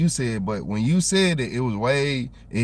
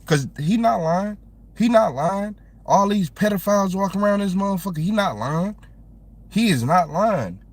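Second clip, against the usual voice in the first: heated agreement; 7 semitones higher than usual. Real, not acted. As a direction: hammer home that he's not lying.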